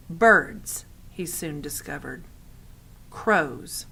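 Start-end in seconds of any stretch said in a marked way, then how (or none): none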